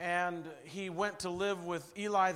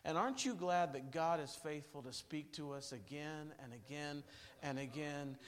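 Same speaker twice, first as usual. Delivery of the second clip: normal talking voice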